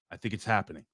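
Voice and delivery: usual speaking voice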